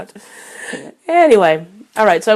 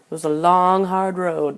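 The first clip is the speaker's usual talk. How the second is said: ordinary talk, nothing marked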